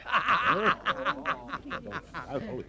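quiet, low knowing laugh; soft sly laugh. Acted. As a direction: laugh softly at your own joke. Sergeant laughs at his joke. (zacht sluw gelach)

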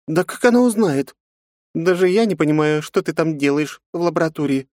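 loud high dismissive scoff; scoffing snort. Acted. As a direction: brush it off with a snort. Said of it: "фыркнула Жанна". (фыркая, пренебрежительно)

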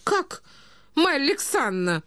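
high-pitched indignant catch-up; indignant interjection. A narrator's reading. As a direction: jump in, affronted, pitch leaping up. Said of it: "подхватила Наталья Дмитриевна". (возмущённо, высоким голосом)

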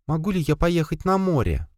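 put-on high, light child pleading; a little child begging father; child's voice. A narrator's reading. Child begging father for the sea, high and light. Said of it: "послышался детский голос".

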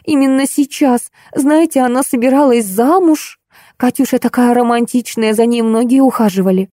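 lightly voiced upset clerk; grieving young clerk. A narrator's reading.

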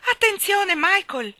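very high, loud alarm; warning cry. Acted. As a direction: shriek a warning to him. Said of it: "ammonì Jane".

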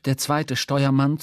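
ordinary talk, nothing marked

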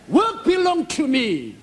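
high, slow, solemn God declaring; lofty divine pronouncement. Retold or imitated. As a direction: lift the pitch and slow it down as God. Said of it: "God came to me and revealed".

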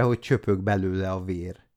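ordinary delivery

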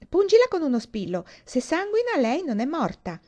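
high, loud and eager; eager kid's idea. A narrator's reading. Kurt urges the others to prick Dee, high and eager. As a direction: push the cruel idea excitedly. Kids testing if Dee fakes.

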